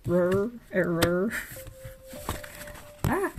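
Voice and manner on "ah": ordinary talk, nothing marked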